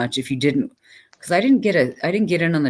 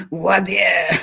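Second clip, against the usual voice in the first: put-on Voir dire in a higher, louder Boston accent, "wah dee-uh"; 5 semitones higher, 7 dB louder than usual. Lawyers answering back in a hammed-up Boston accent. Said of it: "Because they say it funny".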